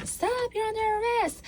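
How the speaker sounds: a nervous rookie cop squeaking out an order; squeaky high-pitched. Retold or imitated. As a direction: voice cracking way up high. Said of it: "my voice just went really high".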